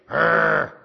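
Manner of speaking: loud mock dog snarl; preacher mimicking the dog doing it again, loud growl. Imitating his dog.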